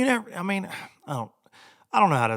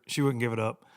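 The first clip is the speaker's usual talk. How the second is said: ordinary delivery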